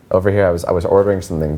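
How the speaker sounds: ordinary delivery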